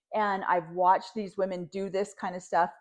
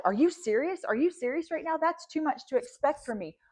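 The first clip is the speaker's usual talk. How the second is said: higher-pitched imitation of an offended woman; mock indignant; 6 semitones higher than usual. Commentator mimicking the wife who gets all mad, mock indignant. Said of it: "And Robin gets all mad".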